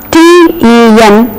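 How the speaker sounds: ordinary talk, nothing marked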